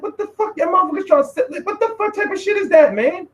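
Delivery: high, shocked what-the-fuck; shocked high outburst. Retold or imitated. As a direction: blurt it out in disbelief. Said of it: "I was like".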